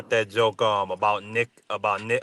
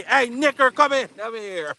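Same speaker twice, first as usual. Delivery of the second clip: high, mock-angry call with the name run together; mad Steve Kerr; 12 semitones higher than usual. Retelling Steve Kerr calling his son Nick, mock mad. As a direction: imitate an irritated dad summoning his son, much higher. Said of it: "anytime Steve Kerr get mad".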